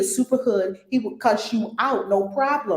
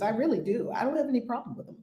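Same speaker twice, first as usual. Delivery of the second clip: normal talking voice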